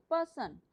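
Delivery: "Person" with weight on पर, falling away on सन; stress on first syllable. Shown as a demonstration. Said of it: "प पे, अ, अ, stress लगेगा". ("Person" में पहले हिस्से पर ज़ोर)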